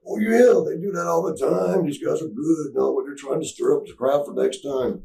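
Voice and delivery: high put-on wrestler voice; smooth things over in a wrestler caricature; Randy Savage. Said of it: "Randy's like".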